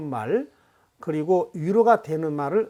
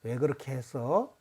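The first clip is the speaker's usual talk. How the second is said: low, hushed, gentle question; much softer and lower, kindly asking; 5 semitones lower, 8 dB quieter than usual. Shown as a demonstration. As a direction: ask it gently, voice dropped low. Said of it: "어, 부드럽지요?".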